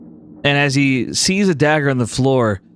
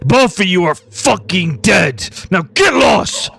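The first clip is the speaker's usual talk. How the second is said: angry threat; 2 semitones higher than usual. Acted. Tyke threatening to kill her in the race, building fury. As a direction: draw out the threat, then explode. Villain threatens both, ordering them away.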